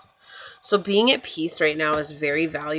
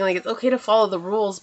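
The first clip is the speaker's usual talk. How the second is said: ordinary talk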